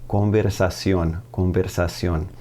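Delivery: "Conversación" as Spanish, stressed on the final syllable; Spanish pronunciation. Shown as a demonstration. Teacher models the noun for learners, Spanish pronunciation. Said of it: "It's all about pronunciation at that point".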